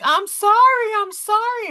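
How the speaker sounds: high, loud, frantic crying apology; hysterical apology. Retold or imitated. Mother to crying daughter after surgery, hysterical sobbing apology. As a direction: beg forgiveness through hysterical tears. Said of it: "And I'm hysterical".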